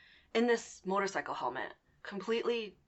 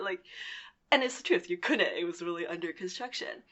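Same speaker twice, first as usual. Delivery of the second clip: ordinary talk, nothing marked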